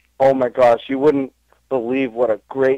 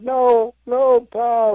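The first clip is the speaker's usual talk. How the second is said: Spanky consoling; 11 semitones higher, much slower than usual. Retold or imitated. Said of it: "he was like".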